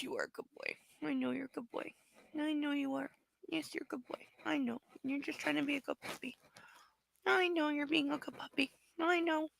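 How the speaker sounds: hushed, tender cooing to a pet. Real, not acted. Cooing softly to the dog.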